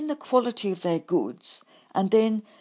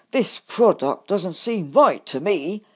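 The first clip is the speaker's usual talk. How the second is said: louder, wary doubt; suspicious; 7 dB louder than usual. A narrator's reading. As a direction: eye the bottle with mistrust. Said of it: "I said suspiciously".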